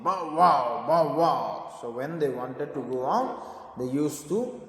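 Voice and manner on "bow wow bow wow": a light mock bark, a little louder; puppy barking. A narrator's reading. Teacher voicing puppies asking to go out, mock bark. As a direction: bark it out like playful pups. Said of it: "they said".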